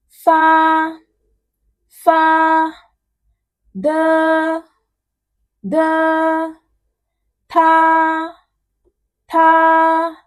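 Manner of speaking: high, level, drawn-out syllables; syllable drill. Shown as a demonstration. Teacher pairing initials and finals, syllable drill. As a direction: hold each syllable long on one high, steady pitch. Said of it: "doing a basic pairing of the initials and finals".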